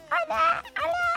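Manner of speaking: sudden very high squeak; squeaky changed voice. Shown as a demonstration. Host showing listeners a voice gone squeaky. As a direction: jump way up into a squeaky little voice. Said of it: "o te vuelvas con una voz más chilloncita".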